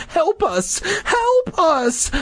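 very high squealed cry; hammed-up panicked shriek; panicked plea. A narrator's reading. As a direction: squeal for help in falsetto. Trapped children calling for help.

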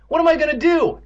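high, loud wail. A narrator's reading. Boy pacing the room nervously, wailing. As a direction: wail helplessly. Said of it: "Evan wailed".

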